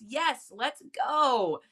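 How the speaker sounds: normal talking voice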